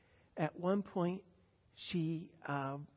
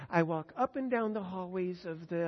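usual speaking voice

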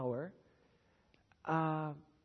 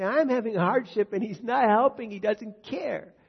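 acted protest; 4 semitones higher, 12 dB louder than usual. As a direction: protest loudly as an aggrieved believer. Acts out a believer's complaint.

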